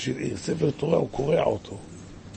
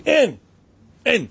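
emphatic cry; 9 semitones higher, 6 dB louder than usual. Real, not acted. Preacher insists with emphatic cry.

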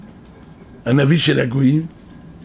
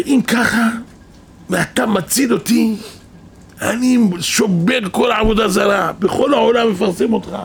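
raised pitch, earnest promise; acted fervent vow; 7 semitones higher than usual. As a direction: vow it aloud like a rescued penitent. Acts out king's vow to God. (נדר נלהב בקול מוגבה)

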